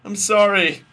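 high, very loud apology; acted shout. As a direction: belt it out like a melodramatic quarrel. Acting Goku's reply in quarrel.

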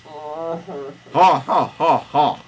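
loud, high, spaced-out ha-ha; hammed-up mocking pharaoh laugh; villain laugh. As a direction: laugh in separate, staged bursts. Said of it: "say Pharaoh".

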